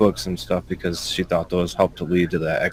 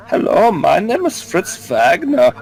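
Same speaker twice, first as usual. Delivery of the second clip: mock German accent, pitched up; 9 semitones higher than usual. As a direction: announce yourself in a hammed-up German accent. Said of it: "just come out here and be like".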